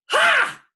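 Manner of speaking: sharp, very high, loud scoffing laugh; one burst of contempt. Retold or imitated. Mother scoffing at the Methodist news, very high and loud.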